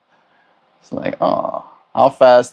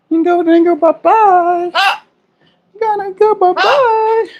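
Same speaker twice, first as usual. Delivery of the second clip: singsong pet talk; 4 dB louder than usual. Real, not acted. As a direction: sing it up high to the bird. Baby talk to bird.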